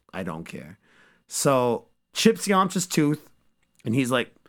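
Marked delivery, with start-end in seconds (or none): none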